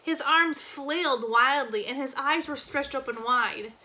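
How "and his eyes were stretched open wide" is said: plain reading voice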